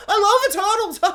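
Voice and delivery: high, loud gleeful squeal breaking into laughter; hammed-up giddy fan outburst; gushing sidekick. Sidekick running round with a camera, mock giddy excitement.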